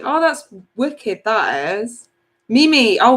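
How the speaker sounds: ordinary talk, nothing marked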